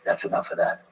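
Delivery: breathy, hushed Clint Eastwood rasp; Clint Eastwood impression. Clint Eastwood ending a take, in a soft whispered rasp. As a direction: say it like a laconic old cowboy director. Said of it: "That's a really good Clint Eastwood".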